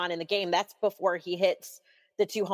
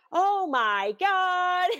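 high, drawn-out imitation of a commentator's call; Bob Costas; 11 semitones higher, much slower than usual. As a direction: imitate an awestruck play-by-play announcer. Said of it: "I believe it's Bob Costas".

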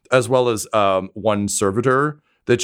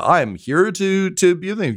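swan in and show off; flamboyant showman; 11 semitones higher than usual. Retold or imitated. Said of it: "very much like a".

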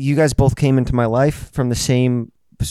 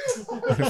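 high burst of laughter; 10 dB quieter than usual. Real, not acted. Laughs at the joke.

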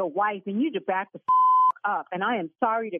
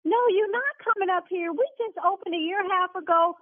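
heated scolding; 7 semitones higher than usual. Real, not acted. Owner rebukes threatening caller.

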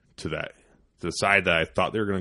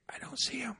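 whisper; 13 dB quieter than usual. Retold or imitated. Reese whispering back in blind.

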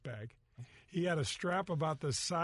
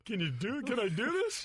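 starstruck; 7 semitones higher than usual. Retold or imitated. Starstruck by James Bond.

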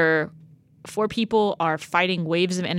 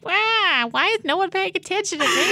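high, whiny mock of Allegra; baby Allegra voice; 11 semitones higher than usual. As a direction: squeak it out like a pouting baby. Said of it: "She's like".

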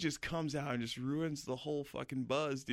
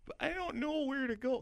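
put-on crying girl's whiny Upper Midwest accent, pitched way up; mock northern Midwest accent. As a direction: imitate her northern accent, high and tearful. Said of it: "'cause they have northern accents up there".

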